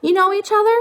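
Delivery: put-on high, childlike little bird voice; gosling voice. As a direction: sing it up like a small child.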